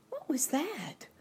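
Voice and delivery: put-on high-pitched, timid girl's question; a startled girl's timid little query. A narrator's reading. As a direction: ask it timidly in a small, high voice. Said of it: "she asked timidly".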